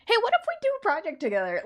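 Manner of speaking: high, fast eager question; excited. Retold or imitated. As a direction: pitch the idea with eagerness. Acted excited friend proposal.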